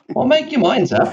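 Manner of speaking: high-pitched, playful retort; mock exasperation. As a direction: throw it back at them, voice jumping up. Mock retort to people judging accent.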